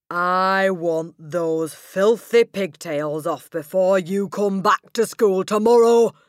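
slow, loud, menacing bark; barking order. Acted. Headmistress barks an order at a terrified girl. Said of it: "she barked".

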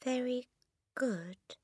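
high, small, hesitant mumble; mumbling. A narrator's reading. Boy praising cake to the cook, mumbling. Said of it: "the boy mumbled".

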